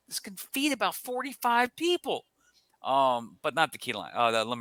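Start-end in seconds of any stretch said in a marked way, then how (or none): 0.1-2.2 mock amazement. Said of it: "I'm like".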